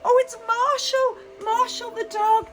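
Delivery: bright, sing-song greetings to each toy, gasping with delight; children's entertainer voice, big and eager; excited kids' presenter. Acted. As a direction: wow the little ones as you greet every guest. Kids' Christmas show presenter.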